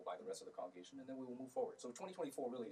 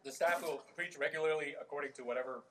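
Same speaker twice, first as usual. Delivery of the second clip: strained and loud, mouth burning; talking through hot sauce pain; 5 semitones higher, 6 dB louder than usual. Real, not acted. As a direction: push the words out through the burn. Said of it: "I'm very emotional right now".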